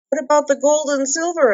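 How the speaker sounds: high, fast worried question; anxious hobbit. A narrator's reading. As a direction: hobbit blurting his question. Bilbo asking quickly.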